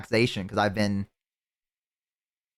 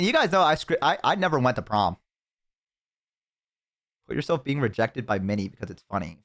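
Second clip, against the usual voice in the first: normal talking voice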